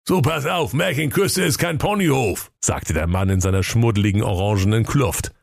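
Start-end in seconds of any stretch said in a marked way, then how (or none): 0.1-2.5 high, mocking workman voice; cheeky workman, teasing him; cheeky sewer worker. (frecher Kanalarbeiter, spöttisch)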